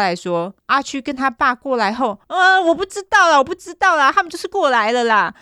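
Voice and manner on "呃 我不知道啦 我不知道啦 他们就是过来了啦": high, loud, hammed-up floundering; mock flustered outburst. Suspect flounders before the detective, mock flustered outburst. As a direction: imitate a suspect losing his thread, voice pitched way up.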